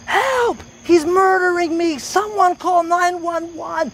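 very high, drawn-out mock scream; imitated screaming. Showing police officers what was heard over the phone, imitated screaming. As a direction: wail for help like a desperate victim, without full volume. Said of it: "that's how loud it was".

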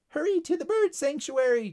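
high, urgent chirp; chirping bird. Acted. Bird warning Tyler of hornets, chirping. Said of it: "chirped the bird".

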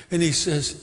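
usual speaking voice

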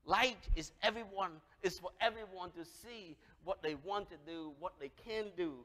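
usual speaking voice

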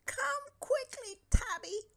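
high, loud desperate yell. A narrator's reading. Stanley yells for Tubby, high and loud. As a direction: scream for help. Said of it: "yelled Stanley".

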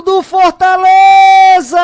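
long, slow, loud goal shout; drawn-out goal cry. Real, not acted. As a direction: hold the club name out.

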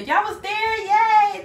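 high, loud happy squeal on Yay; excited cheer. Real, not acted. Viewers from day one.

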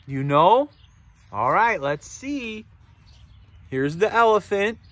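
usual speaking voice

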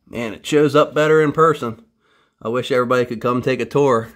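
usual speaking voice

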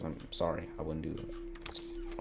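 soft whispered aside to chat. Real, not acted. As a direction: lean in and whisper it. Confides aside to viewers.

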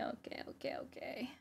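very soft, voiceless whisper; self-soothing whisper. Real, not acted. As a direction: whisper it quickly to calm yourself down. Calming self after the outburst.

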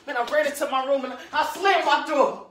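high, loud outburst; worked-up child. Acted. Child fleeing the screaming.

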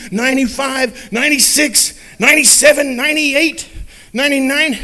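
each number climbs in suspense; rising urgency in the count; building count. A narrator's reading. Counting sheep, rising tension.

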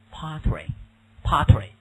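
pottery said slowly twice; teacher drilling pottery slowly; slow drilled word. Shown as a demonstration. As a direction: drawl out pottery. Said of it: "不要加复数".

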